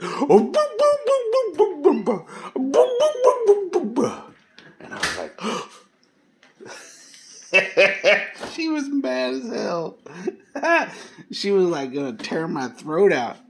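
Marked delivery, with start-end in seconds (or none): 0.0-4.1 much higher, louder yelping barks; imitating dog howls. Dog spins round barking. 8.5-10.9 much higher, giggling through the words; can't stop laughing as you tell it. Real, not acted. Laughing while retelling the scare.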